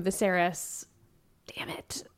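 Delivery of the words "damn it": whispered curse. Real, not acted. Reacting to the king's death.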